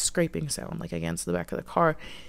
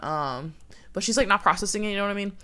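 usual speaking voice

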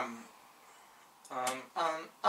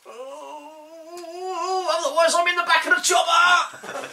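mock panicked shout; 10 semitones higher than usual. Comic panic about chopper.

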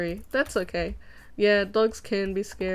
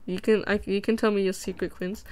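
ordinary talk, nothing marked

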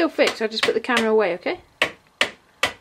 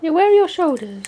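put-on reading to a toddler; 5 semitones higher, 6 dB louder, slower than usual. Mother playing with baby.

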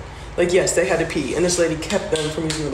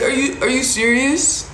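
incredulous; 7 semitones higher, slower than usual. Retold or imitated. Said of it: "I was just like".

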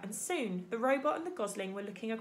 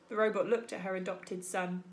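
ordinary delivery, nothing marked

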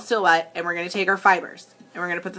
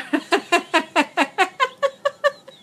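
long, high staccato laugh; high cackling laugh; 11 semitones higher than usual. Real, not acted. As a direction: crack up in a long rattling cackle. Laughs at own silly outburst.

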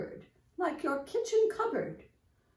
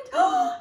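sharp, high gasp; frightened gasp; 7 dB louder than usual. Shown as a demonstration. Teacher showing children Miss Muffet's fright, sharp gasp. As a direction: gasp as if a spider dropped down.

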